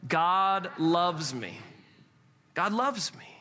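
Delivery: very slow, each word drawn out; stretched-out call to recite together; leading unison recitation. Shown as a demonstration. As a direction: lead the room through it word by word, slowly. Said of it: "Say it with me".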